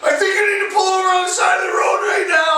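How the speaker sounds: mock panicked wife, high and speeding up; high-pitched urgency, re-enacted; freaking out. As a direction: imitate frantic pleading from the passenger seat. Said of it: "she's freaking out".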